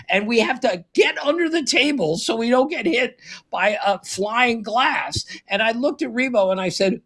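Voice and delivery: usual speaking voice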